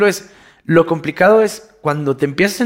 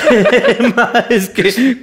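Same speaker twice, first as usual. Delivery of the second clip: breaks into a laugh mid-line; real chuckle slipping into the sentence; 7 semitones higher, 3 dB louder, slower than usual. Real, not acted. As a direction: laugh through the words, pitched up.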